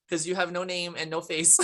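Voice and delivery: normal talking voice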